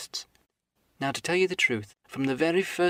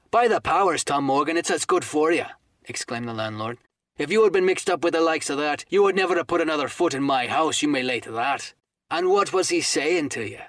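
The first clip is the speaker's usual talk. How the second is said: blustering landlord; 3 semitones higher, 5 dB louder than usual. Acted. Silver berates Morgan for bad company, loud. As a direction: thunder at the old sailor.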